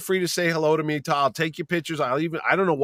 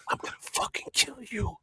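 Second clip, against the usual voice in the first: hissed whisper imitating a furious wife; menacing whisper; 8 semitones higher, 10 dB quieter than usual. Wife whispers in husband's ear at the table, menacing whisper. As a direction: whisper a death threat right in the ear. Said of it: "she whispers in my ear".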